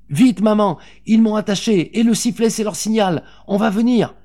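put-on very high, loud child's warning; urgent alarm. A narrator's reading. Captive boy to his mother, urgent alarm. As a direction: warn your mother in a panic.

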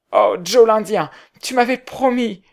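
high, loud despairing cry; anguished cry. A narrator's reading. Potter crying out to Joe l'Indien, high and loud in despair. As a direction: cry out at a betrayer. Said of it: "s'écria".

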